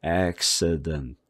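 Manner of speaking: accident very slow, middle vowel barely there; reduced schwa vowel. Shown as a demonstration. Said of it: "어 소리로 아주 있는 듯 없는". (accident 가운데 어 소리 약하게)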